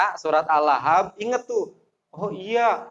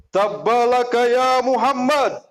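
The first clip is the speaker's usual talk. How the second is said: higher, louder cursing uncle; uncle's curse; 8 semitones higher, 4 dB louder than usual. Retold or imitated. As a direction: hurl the curse at your nephew. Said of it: "Ini paman yang ngomong". (paman mengutuk keponakan dengan marah)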